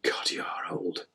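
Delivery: soft, slow, near-voiceless aside; hushed inner thought. Retold or imitated. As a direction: think it aloud under your breath, slowly. Thinking aloud at own reflection.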